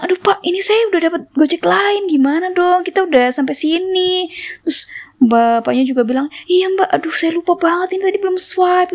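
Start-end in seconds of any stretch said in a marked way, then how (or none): 0.0-4.3 high, flustered pleading; retold worried protest to the driver; dismayed complaint. Passenger telling driver she got another Gojek, high and dismayed. 6.5-8.9 high-pitched dismayed admission; flustered apology. Retold or imitated. Driver admitting he forgot to swipe, high and flustered.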